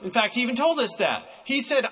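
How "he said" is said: ordinary delivery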